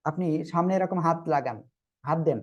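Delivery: normal talking voice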